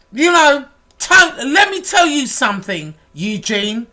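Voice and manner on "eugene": normal talking voice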